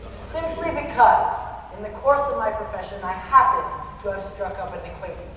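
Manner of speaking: loud, affronted tirade; pompous indignation. Acted. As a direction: bristle with wounded pride. Critic indignantly defends himself.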